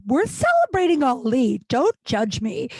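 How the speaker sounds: high, indignant acted protest; defensive retort. Drunk woman answering her ex, defensive and high.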